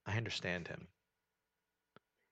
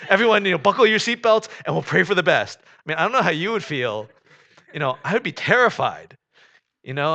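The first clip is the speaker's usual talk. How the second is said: preaching at full force; 3 semitones higher, 10 dB louder than usual. Acted. Pastor to congregation about Jonah, preaching at full force. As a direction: drive each "not" home to the congregation. Imagining a terrifying flight.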